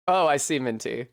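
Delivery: normal talking voice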